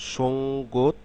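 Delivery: ordinary talk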